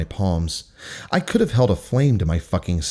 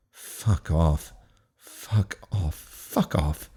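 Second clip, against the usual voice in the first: softer, muttered fuck off under the breath; muttered curses; 4 semitones lower, 6 dB quieter, much slower than usual. Acted. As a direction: seethe the words to yourself. Silent seething at the principal.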